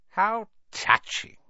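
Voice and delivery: slow, mocking hiss. A narrator's reading. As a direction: sneer the compliment. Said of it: "it hissed".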